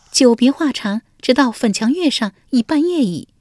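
high, coy court lady voice; lady Wenjiang. A narrator's reading. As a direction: answer sweetly as the young wife. (文姜娇声作答)

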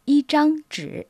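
"yi zhang zhi" with "zhang" high and level; teacher models the measure word in Chinese. Shown as a demonstration. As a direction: model the Chinese phrase clearly. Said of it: "Il se dit en chinois".

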